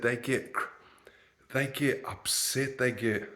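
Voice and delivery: ordinary talk, nothing marked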